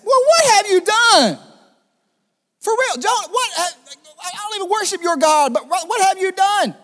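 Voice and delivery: high, loud, put-on frightened voice; panicked sailors. Sailors to Jonah, high and panicked demanding what he did.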